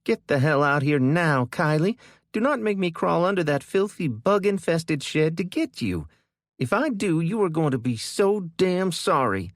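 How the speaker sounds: high, loud threatening yell; angry yelling. A narrator's reading. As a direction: threaten her sister angrily. Kendra threatening sister.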